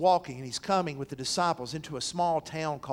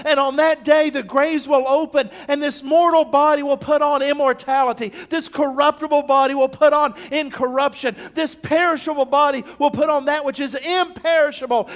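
loud, high, driving sermon climax; full-force preaching; 10 semitones higher, 12 dB louder than usual. Acted. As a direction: preach it out to the whole congregation.

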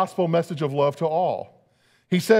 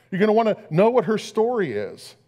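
ordinary delivery

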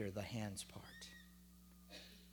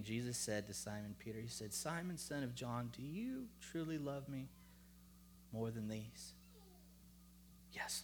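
soft, hushed storytelling; gentle scripture reading; 5 dB quieter than usual. Real, not acted. Preacher reading Jesus questioning Peter, soft and solemn. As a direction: read the exchange tenderly, leaving pauses.

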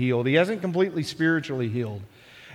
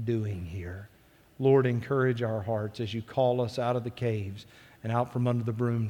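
soft, lowered prayer voice; 3 semitones lower, 6 dB quieter than usual. Real, not acted. Preacher prays for the congregation after the reading, hushed. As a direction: pray gently, settling lower toward the amen.

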